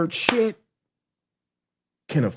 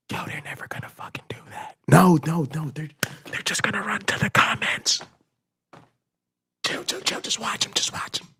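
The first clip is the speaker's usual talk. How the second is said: whisper; 6 dB quieter than usual. Retold or imitated. Billionaires whispering their scheme.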